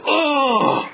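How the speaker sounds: high startled "Oh!" at the hit; surprised oh. Retold or imitated. Friend seeing Colossus hit for the first time, high startled oh. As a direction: yelp at the screen shaking. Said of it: "He said".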